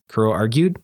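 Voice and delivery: usual speaking voice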